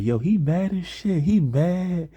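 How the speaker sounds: high-pitched mocking girl voice; teasing girls. Two girls laughing at a classmate, high teasing voice. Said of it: "two girls next to him laughing as well".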